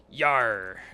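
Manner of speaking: loud pirate shout of yar. Acted. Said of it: "sailor voice".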